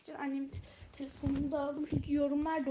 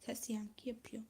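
whisper; 3 semitones lower, 8 dB quieter than usual. Real, not acted. Aside about the echo.

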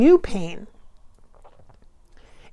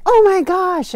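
loud, high oh my gosh; overwhelmed exclamation; 10 semitones higher, 7 dB louder, slower than usual. Retold or imitated. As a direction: blurt it out, flustered and overwhelmed. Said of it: "and you're like".

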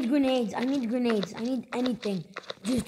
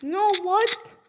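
shocked disbelief; 9 semitones higher than usual. Real, not acted. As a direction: cry out in disbelief. Shocked at game event.

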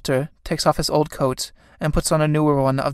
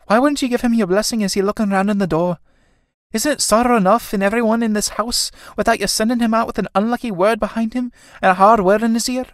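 high-pitched, indignant scolding; reproachful daughter; 7 semitones higher than usual. Acted. Kathleen scolds her mother over the blessing, high reproach.

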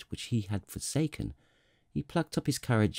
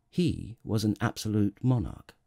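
usual speaking voice

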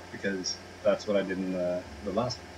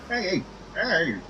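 higher, louder mock yell; mock shout; 10 semitones higher, 6 dB louder than usual. Gamer yelling at a character to leave his car, mock shout. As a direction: shoo someone out, hammed up.

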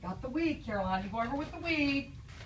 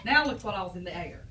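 put-on reading to children; 2 semitones lower, 6 dB quieter than usual. Teacher reading a picture book to the class, storybook voice. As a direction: draw the kids into the story.

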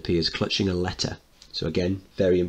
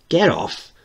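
put-on child voice; 10 semitones higher, 5 dB louder than usual. Child refusing mother's dance.

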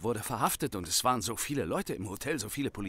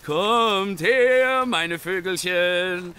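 higher and louder sing-song call to the geese; 9 semitones higher, 6 dB louder, much slower than usual. Acted. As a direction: lure the birds over with a bright call. Calling the geese in the yard.

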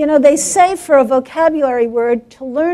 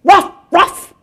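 loud, higher imitation dog barks; cat barking like dog; 6 semitones higher, 7 dB louder than usual. Mother cat turns to the dog, loud barking. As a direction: bark back as boldly as any dog. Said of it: "and she goes".

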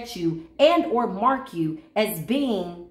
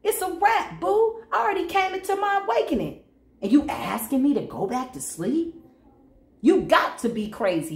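sassy dismissal; 5 semitones higher than usual. Retold or imitated. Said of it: "We had a conversation with this devil".

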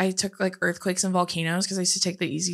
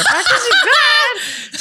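laughing; 8 dB louder, slower than usual. Retold or imitated. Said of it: "And I'm like".

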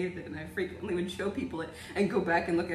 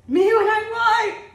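high, loud meme-style yell; exaggerated comic outburst, pitch way up; mock shouting; 12 semitones higher, 10 dB louder than usual. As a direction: belt it out as a joke.

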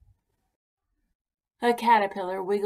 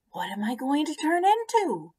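eager pollywog; 8 semitones higher than usual. Acted.

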